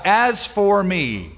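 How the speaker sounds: "As for me" stretched out slowly, heavy stress; emphatic as for me. Shown as a demonstration. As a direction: drag out "As for me" with weight. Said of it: "an emphatic self-reference".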